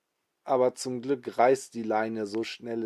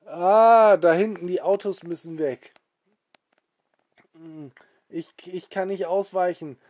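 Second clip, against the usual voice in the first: high, loud alarmed cry, then a higher worried plea; panicked cry; 7 semitones higher, 7 dB louder than usual. Acted. Alex panicking he cannot dodge the cars, high alarm. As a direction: sound alarmed as the cars rush at you.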